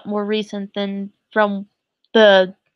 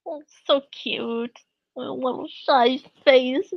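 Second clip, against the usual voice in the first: soft, slow, high teary cooing; softer, tearful, higher gushing; crying of joy; 5 semitones higher, 3 dB quieter, slower than usual. Real, not acted. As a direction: coo over the picture while tearing up. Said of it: "Fuck, I'm crying now".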